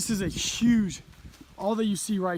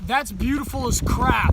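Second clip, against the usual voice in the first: awed excitement; 6 semitones higher, 8 dB louder, slower than usual. Real, not acted. First sight of mountain view.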